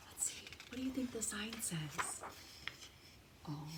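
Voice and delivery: hushed teasing whisper. Real, not acted. Teacher asking children to guess the sign, whispered.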